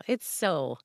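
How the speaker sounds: ordinary delivery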